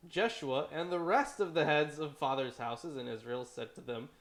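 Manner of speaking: normal talking voice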